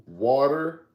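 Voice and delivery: water as wadder; saying water. Shown as a demonstration. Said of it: "the last one we got is gonna be".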